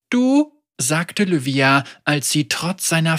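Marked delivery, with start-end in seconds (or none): 0.1-0.5 put-on high, surprised child; a sudden startled question; surprised girl. A narrator's reading.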